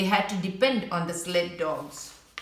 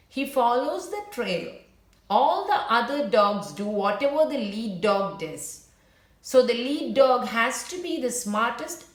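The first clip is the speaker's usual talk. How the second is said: clear, gentle storybook reading; reading to children; 3 semitones higher than usual. A narrator's reading. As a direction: read the story aloud to young listeners. Teacher reads story to class.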